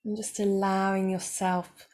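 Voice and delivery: calm, unhurried voice with long pauses; guided meditation. Acted. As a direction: guide them gently into stillness, leaving space between phrases.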